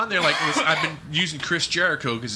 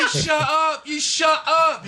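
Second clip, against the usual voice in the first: high clipped British "You shut up"; high mock British retort; mock English kid; 10 semitones higher, slower than usual. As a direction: imitate the kid snapping back. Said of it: "what happened to fucking enunciating the T at the end of shut".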